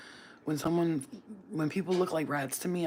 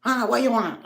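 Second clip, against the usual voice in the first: rude cook; 5 semitones higher, 4 dB louder than usual. Retold or imitated.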